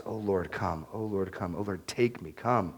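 low, hushed plea to God; acted yearning, under the breath; longing prayer. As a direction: pray it softly and low, over and over.

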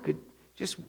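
hemming and hawing, with false starts; acted reluctant prayer, tripping over itself; hesitant bargaining.

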